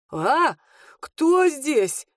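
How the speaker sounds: high startled shout; startled cry. A narrator's reading. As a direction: jump up frightened. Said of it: "выскочил из тряпок Евсей".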